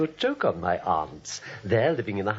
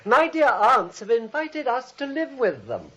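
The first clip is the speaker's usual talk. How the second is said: loud announcing; 12 semitones higher, 5 dB louder than usual. Acted. Jonathan announcing loudly, menacing.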